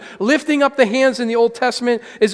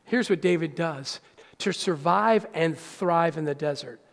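normal talking voice

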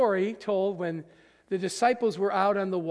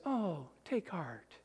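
soft, slow and half-hearted; limp take heart; 3 semitones lower, 12 dB quieter, slower than usual. Acted. Jesus made to sound weak, soft and limp. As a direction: shrug it off with no conviction. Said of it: "It's none of this".

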